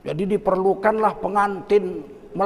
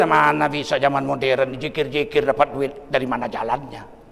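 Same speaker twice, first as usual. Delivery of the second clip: much lower voice of scepticism; doubting inner reason; 8 semitones lower than usual. Retold or imitated. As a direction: voice the doubter's thoughts, low. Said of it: "Apa kata akal kita?". (suara akal yang ragu, rendah)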